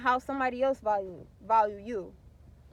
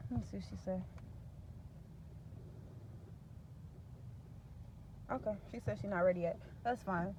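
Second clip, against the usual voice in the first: muttered aside; 4 semitones lower, 13 dB quieter, faster than usual. Real, not acted. Reads a text aside.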